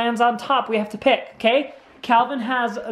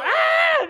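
playful yelp. Real, not acted. Ball hits during dodgeball.

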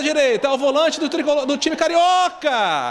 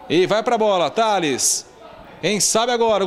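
usual speaking voice

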